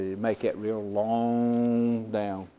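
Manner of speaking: "long" stretched way out, low; low voice, lingering on "long"; drawn-out LONG. Retold or imitated. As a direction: draw the word "long" right out like the line it describes. Imitating the monitor's long dip.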